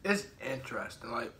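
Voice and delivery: talking while chewing; muffled by food; mouth full, chewing. Real, not acted. Seen 1: Bites chocolate at -12 s; cheeks bulge chewing through 4.5 s.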